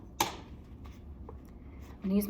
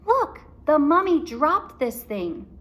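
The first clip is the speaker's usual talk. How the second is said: higher, louder discovery; excited Annie; 7 semitones higher, 5 dB louder than usual. A narrator's reading. Annie showing Jack the dropped thing, excited. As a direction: point it out eagerly. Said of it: "Annie discovers stick".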